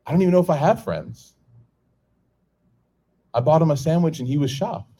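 ordinary delivery

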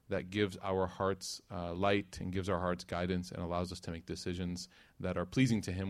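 low, even supplication; closing prayer. Real, not acted.

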